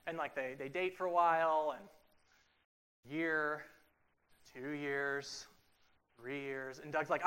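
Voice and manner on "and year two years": ordinary talk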